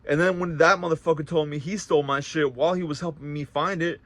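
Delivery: ordinary talk, nothing marked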